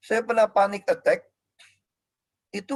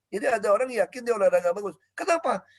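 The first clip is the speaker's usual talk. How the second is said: ordinary delivery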